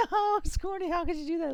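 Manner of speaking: high-pitched hammed-up lament; mock dismay. Player inwardly lamenting a pancaked teammate, mock dismay. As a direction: wail in theatrical reproach. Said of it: "in my head, I was like".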